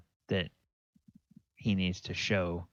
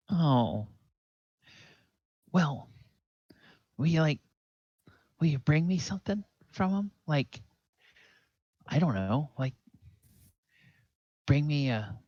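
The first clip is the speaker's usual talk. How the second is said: put-on high-pitched, hopeful kid voice; small boy haltingly wheedling; young boy, hesitant pleading; 9 semitones higher, faster than usual. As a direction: ask like a boy angling for a present.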